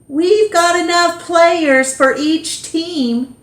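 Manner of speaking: put-on an eager boy rallying the team; JT's voice. JT speaks to the team.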